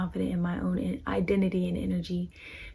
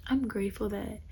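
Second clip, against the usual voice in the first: ordinary talk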